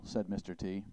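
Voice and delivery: ordinary delivery, nothing marked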